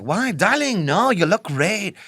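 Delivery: high, gushing attempt at South African; poor South African accent. Photographer reassures her in a badly imitated South African accent. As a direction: exaggerate a glamorous South African, drawing out the end. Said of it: "in a South African accent that I can't do".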